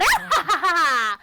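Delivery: gloating villain cackle; evil laugh. Acted. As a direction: laugh like a scheming wizard. Villain laughs after plotting.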